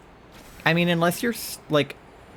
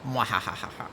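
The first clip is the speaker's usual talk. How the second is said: mad scientist cackle; 3 semitones lower, 6 dB quieter than usual. Pretend. Gloating after banning Charlie.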